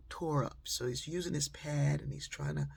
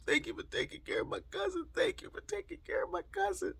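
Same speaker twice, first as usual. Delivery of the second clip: high, fast, tearful voice; crying thanks. Retold or imitated. Cousin on the phone thanking her through tears, high and tearful. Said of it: "his cousin's crying".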